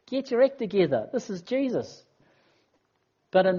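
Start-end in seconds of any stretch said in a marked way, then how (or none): 0.0-2.0 laughing, mock-exasperated plea, higher; a half-laughing get your act together; preacher chiding the disciples with a laugh. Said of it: "often I'll think to myself".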